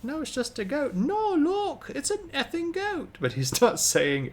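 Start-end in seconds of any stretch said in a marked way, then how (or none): 0.0-1.9 very high imitated toddler voice; little kid impression. Streamer retells kid arguing about the goat, high child voice. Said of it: "the little kid has this really thick accent". 1.9-3.2 put-on very high toddler voice with thick accent; little kid impression. Streamer quotes kid about backyard goat, high little-kid voice. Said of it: "the little kid has this really thick accent".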